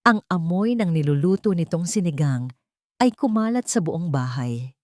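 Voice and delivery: ordinary delivery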